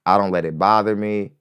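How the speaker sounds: normal talking voice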